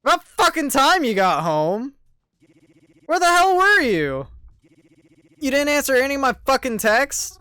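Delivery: loud, furious yelling at her; angry shouting. Acted. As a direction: bark it at her, fuming. Angry boyfriend yelling at Mary.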